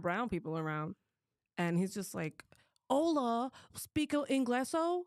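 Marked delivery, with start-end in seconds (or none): 2.9-5.0 high, drawn-out condescending mock Spanish; patronizing old man's fake Spanish; mocking condescension. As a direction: imitate someone talking down in pidgin Spanish, pitched up and slow. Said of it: "tries to be, like, a little racist".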